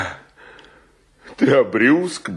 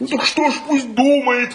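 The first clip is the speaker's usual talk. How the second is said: high-pitched, exasperated retort; impatient outburst. Acted. Friend dismissing what landlady thinks, exasperated. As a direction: throw it back at him, pitch leaping up.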